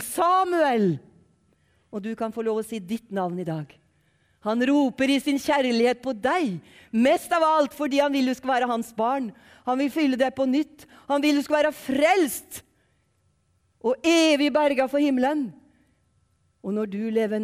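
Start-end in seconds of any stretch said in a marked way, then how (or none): 0.0-0.9 high, loud call of a name. Acted. The Lord calling Samuel once more, raised calling voice. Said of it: "Da ropte Herren en gang til". 4.5-15.5 loud, insistent gospel appeal; fervent preaching. Acted. Preacher urging listeners to be saved, loud fervent appeal.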